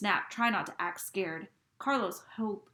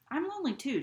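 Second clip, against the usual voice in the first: ordinary delivery, nothing marked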